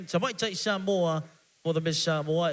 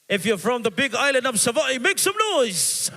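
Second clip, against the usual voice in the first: hype call; 5 semitones higher, 4 dB louder than usual. Real, not acted. MC hyping up the crowd.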